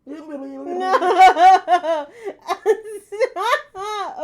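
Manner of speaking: high shrieking laughter, very loud; being squeezed hard. Real, not acted. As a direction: squeal to make them stop.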